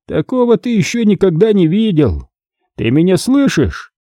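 high-pitched menacing phone caller; angry, triumphant. A narrator's reading. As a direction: deliver the death threat high and gloating. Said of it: "В голосе звучала злость и что-то ещё. Триумф". (злорадная угроза высоким голосом)

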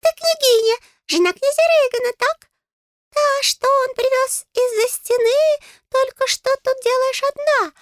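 very high, squeaky little voice; high-pitched fairy, questioning and fussing; chatty, nosy fairy. A narrator's reading. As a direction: pipe up like a tiny busybody. Fairy Gustav speaks.

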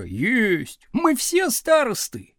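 gleeful, stretched-out call; jubilant cry. A narrator's reading. Back row teasing teacher, jubilant cry. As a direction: crow it, climbing high. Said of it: "ликующе завопила галёрка".

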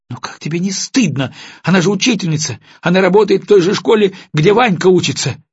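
выше и громче, с упрёком; упрекнуть мужа в ответ. A narrator's reading. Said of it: "с упрёком заговорила Алиса". (жена с упрёком отвечает мужу)

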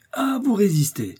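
high-pitched outcry; indignant exclamation. A narrator's reading. Jussac finding the musketeers will resist, indignant exclamation. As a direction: exclaim it high at their defiance. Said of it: "s'écria Jussac".

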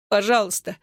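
put-on small, high-pitched child's plea; tearful begging. A narrator's reading. Boy begs his father behind his back, tearful. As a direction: beg it with a touch of tears. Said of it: "со слезами прошептал Энтони".

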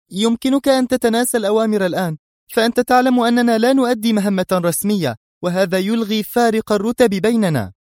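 high, heated pushback; exasperated retort. A narrator's reading. Mona defying her commander's order, exasperated. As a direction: throw his rank back at him. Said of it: "هتفت في حنق".

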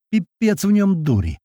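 exasperated cry to everyone around; acted yell with restrained volume. A narrator's reading. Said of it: "ору на весь лес".

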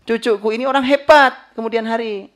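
high, loud proud declaration; proud grandfather. Retold or imitated. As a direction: proclaim the grandson's greatness proudly. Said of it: "berkata positif seperti kalimatnya Abdul Muttalib".

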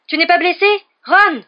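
high, loud calls across the rubble; anxious calling. A narrator's reading. Harry calls to Ron behind the rocks, worried and loud. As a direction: shout to be heard through rock.

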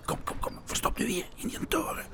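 urgent whisper, voice dropped. Acted. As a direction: hush it so nobody hears. Hiding the dog secretly.

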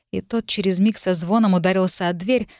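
usual speaking voice

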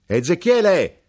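loud high shout of the name; knife-grinder shouting while walking ahead; shouted again. Acted. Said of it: "gridò di nuovo l'arrotino".

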